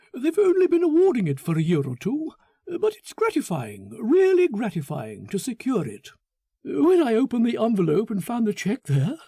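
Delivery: high, bright, self-satisfied chatter; a gushing, smug man showing off his prize; the narrator voices Foster pleased with himself; Foster, eager and pleased. Acted.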